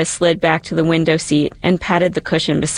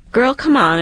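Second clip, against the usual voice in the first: high-pitched, wheedling plea; lightly acted, higher-voiced coaxing; 7 semitones higher than usual. A narrator's reading. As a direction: talk her into it. Cynthia coaxing reluctant Danielle.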